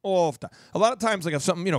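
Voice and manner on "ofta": drawn-out Minnesotan exclamation; Minnesota accent. Retold or imitated. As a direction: imitate a Minnesotan, long open vowels. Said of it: "another thing people say".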